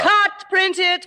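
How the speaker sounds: loud, high sarcastic call of cut; mock director's shout. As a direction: bark it like a director ending a take. Mock director calling end of scene.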